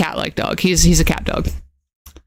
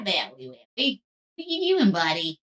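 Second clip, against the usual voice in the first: soft dog talk; 9 dB quieter than usual. Real, not acted. Softly calling the dog.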